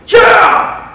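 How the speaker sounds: high, explosive cheer; fired-up team shout. Retold or imitated. Players won over by their coach, shouting eagerly.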